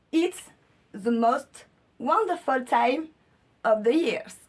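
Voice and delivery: slow, showy attempt at an English accent; killer English accent. Shown as a demonstration. Reading the pyjama slogan to viewers, showy English accent. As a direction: read it out slowly in your proudest English accent. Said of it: "je vais vous faire mon accent anglais qui tue".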